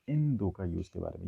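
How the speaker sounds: ordinary delivery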